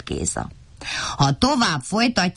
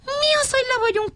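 light squeaky little character voice; surprised little creature asking; little high voice; faster than usual. A narrator's reading. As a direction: ask it in a small, high, puzzled voice. Vacska Matti voiced in story.

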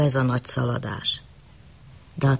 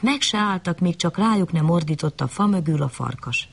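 ordinary delivery, nothing marked

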